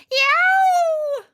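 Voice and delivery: high shouted yelp of pain; tree screaming. A narrator's reading. The tree screaming as the axe strikes it. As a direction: cry out like a wounded tree. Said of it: "the tree screamed".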